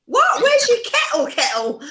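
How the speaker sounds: high, loud, incredulous What; baffled disbelief. Retold or imitated. Looking for a kettle at a friend's house, baffled and high. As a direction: ask for the kettle in total disbelief. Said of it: "I'm going".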